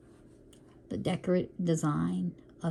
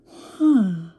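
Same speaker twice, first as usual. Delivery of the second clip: loud yawn; 3 semitones higher, 10 dB louder than usual. Real, not acted. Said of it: "Yawning, you guys".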